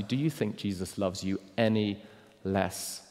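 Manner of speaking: ordinary delivery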